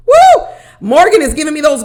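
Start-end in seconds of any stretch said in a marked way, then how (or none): 0.1-0.5 a shrill-free laughing "Woo!", much higher and louder; high laughing whoop. Real, not acted. Laughing whoop after penis joke.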